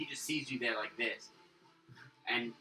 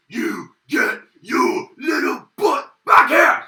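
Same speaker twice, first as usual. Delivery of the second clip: higher, much louder, very slow bellow; angry troll yell; 12 semitones higher, 19 dB louder, much slower than usual. Acted. Troll chasing the running player, slow furious bellow. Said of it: "and he goes".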